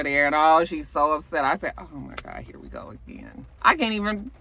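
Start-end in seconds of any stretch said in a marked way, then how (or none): 1.8-3.4 low, near-whispered exasperation; hushed, resigned aside; weary muttered dread. Retold or imitated. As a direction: say it under your breath, fed up. Her weary reaction to the drama.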